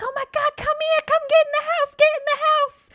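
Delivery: very high, loud, frantic calling; panicked mom. Retold or imitated. Mom at front door to screaming kid, panicked. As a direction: imitate a shrieking mother urging hurry.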